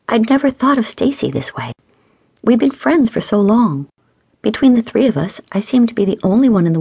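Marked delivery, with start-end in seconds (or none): none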